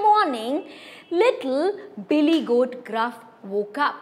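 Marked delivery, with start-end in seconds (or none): none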